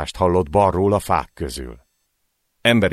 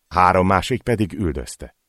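plain reading voice

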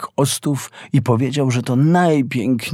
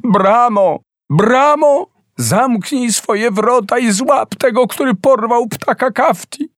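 desperate cry; 11 semitones higher than usual. A narrator's reading. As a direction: cry out in despair, pitch soaring on the second Bramo. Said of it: "krzyknęła w rozpaczy".

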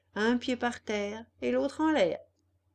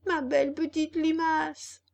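high, louder wheedling puppet call; calling from the street; 7 semitones higher, 4 dB louder than usual. Acted. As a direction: cry it up from the street, sweet-talking. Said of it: "cria Pinocchio de la rue".

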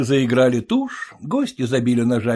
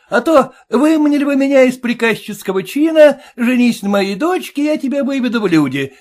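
a high, pinched voice, louder, needling the father-in-law; mocking groom; 10 semitones higher, 5 dB louder than usual. Groom taunting his stingy father-in-law, high and mocking. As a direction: jeer at him in a sing-song. Groom demands note again.

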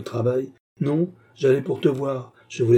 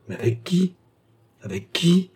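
high, insistent questions rising at the end; anguished questioning; 7 semitones higher than usual. A narrator's reading. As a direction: press anxiously for the answer. Worried lover presses for an answer. (interrogation angoissée, voix haute)

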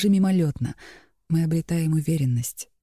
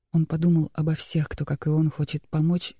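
usual speaking voice

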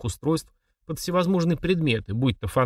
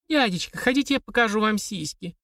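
put-on much higher, squeaky girlish voice; 10 semitones higher than usual. A narrator's reading. Girl's voice echoing in his memory, high squeaky taunt. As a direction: squeak it like a brazen young girl. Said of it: "пропищал в голове девичий голос".

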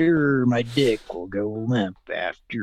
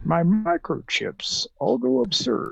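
Patrick Stewart robot; 8 semitones higher than usual. Acted. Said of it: "do the voice of the robot".

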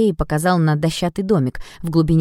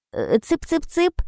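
high slow chick-chick call; coaxing call; 11 semitones higher, 4 dB quieter, slower than usual. A narrator's reading. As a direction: coax it like feeding ducks. Calling the dragon like ducks.

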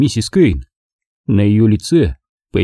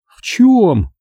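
anxious Margo; 11 semitones higher than usual. A narrator's reading.

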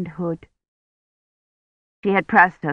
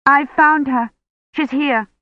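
raised, higher call; 8 semitones higher, 5 dB louder than usual. A narrator's reading. Woman tells the priest of the found child, calling out. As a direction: call out the news across a distance. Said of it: "Kivrin called".

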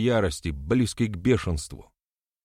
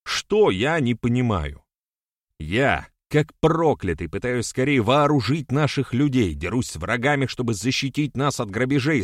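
raised-voice indignant tirade; getting worked up; 4 semitones higher, 4 dB louder than usual. A narrator's reading. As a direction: pour out the grievance, pitch pushed up. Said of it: "начал он заводиться". (возмущённо, на повышенных тонах)

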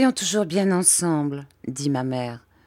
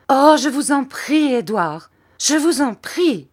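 high, louder anguished plea; wife cries out, begging her husband; crying out; 6 semitones higher, 4 dB louder than usual. A narrator's reading. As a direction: cry out, begging. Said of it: "cria ma mère".

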